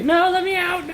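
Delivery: mock trapped yelp; mock panic. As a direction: yelp like you're trapped in a cage. Trapped in rickety elevator cage.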